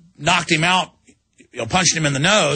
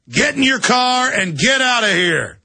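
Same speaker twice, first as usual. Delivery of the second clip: slow, raised, pitched-up order; mock angry cop; 7 semitones higher than usual. Trooper orders him away.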